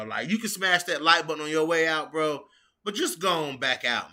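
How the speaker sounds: ordinary talk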